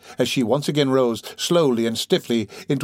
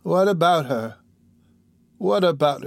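higher-pitched, wandering echo of the question; drowsy sick woman; 6 semitones higher than usual. Acted. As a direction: repeat it as if drifting off, groping for the memory. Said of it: "relapsing into her former drowsy state".

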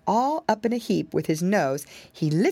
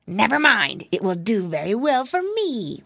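a touch of a sly, smug fox; lightly voiced fox brushing him off; a hint of a crafty fox, unbothered; 3 semitones higher than usual. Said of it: "went on the voice".

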